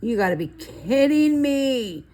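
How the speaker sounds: you can't be serious, mock outrage; exasperated disbelief. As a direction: throw up your hands in disbelief. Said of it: "I'm like".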